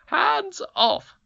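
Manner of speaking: high, loud triumphant squeal; triumphant cry. A narrator's reading. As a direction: crow it in victory. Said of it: "cried the Queen triumphantly".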